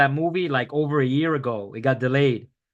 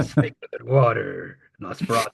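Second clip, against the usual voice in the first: low, heavy tagline read; dramatic trailer voice; 4 semitones lower than usual. Acted. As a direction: intone it like a poster slogan.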